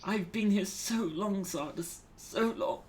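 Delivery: high, sighing, exhausted captive; weary sigh. Acted. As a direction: sigh it out, worn and longing. Captive sighs to her rescuer.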